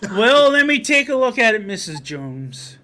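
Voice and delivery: high and loud, dropping at the end; hammed-up repairman stepping in to help; John Candy's food repairman. Repairman offering to inspect a casserole, loud hammed-up voice. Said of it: "John Candy as the food repairman".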